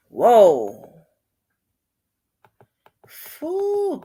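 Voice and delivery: ordinary talk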